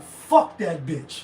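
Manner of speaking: mock venomous grudge; hateful venom. Shown as a demonstration. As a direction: say it like you truly mean harm. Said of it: "You don't mean, like".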